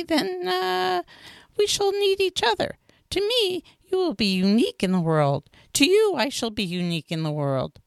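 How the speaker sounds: fox character voice. A narrator's reading.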